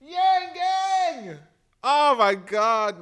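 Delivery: high, loud, stretched-out cheer; slow, loud gasp of delight; fan cheer. Real, not acted. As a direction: cheer it like a rally crowd.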